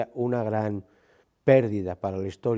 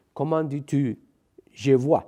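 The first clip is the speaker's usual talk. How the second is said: lightly French-voiced question, louder on je bois; 4 semitones higher, 5 dB louder, slower than usual. The French interviewer asks the gitano, in light French. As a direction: ask it like a Frenchman. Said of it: "Es decir".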